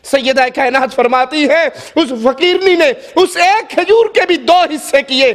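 ordinary delivery

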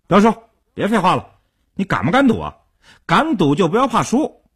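impatient. A narrator's reading. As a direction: push him to bet, losing patience. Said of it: "阿生已经有点急不可耐了".

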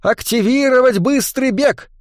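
high, urgent cry; high-pitched exclamation; cried-out command. A narrator's reading. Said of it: "выкрикнул".

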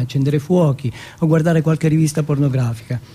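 ordinary talk

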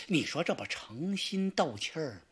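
ordinary delivery

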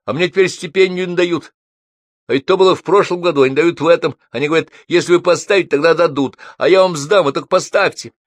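высокий, громкий, напористый студент; уговаривай поставить зачёт, не отступая; упрашивает. A narrator's reading. (настойчиво клянчит)

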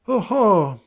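high, loud knowing aha; knowing doctor. Acted. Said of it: "he says".

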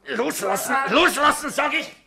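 very high and loud, desperate; panicked shouting in a scuffle; frantic yell. Acted. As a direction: scream at him to let go. Grabbed in a brawl.

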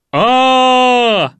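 long, high, very loud yell; истошный ор. Acted. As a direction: yell "aaa" at full voice. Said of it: "встал и заорал". (протяжно заорать)

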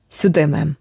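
ordinary delivery

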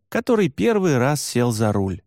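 ordinary delivery, nothing marked

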